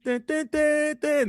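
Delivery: high sung-out theme beat; imitated entrance theme. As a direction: mimic the opening hits of the music. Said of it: "as soon as you hear that jank".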